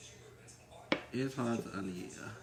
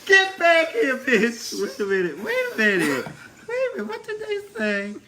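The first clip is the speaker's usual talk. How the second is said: high, louder laughing outcry; playful shocked yelling; shrieking in mock outrage; excited shout; 9 semitones higher, 4 dB louder than usual. Real, not acted.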